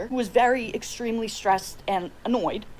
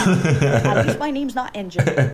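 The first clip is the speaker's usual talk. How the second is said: slow punchline; 4 semitones lower, 7 dB louder, slower than usual. Real, not acted.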